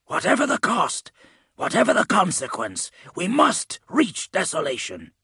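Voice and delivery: high, fervent warrior voice, driven and measured; impassioned zealot. A narrator's reading. Urging a comrade on to Desolation, impassioned. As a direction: press each phrase harder, rising to a strained high pitch.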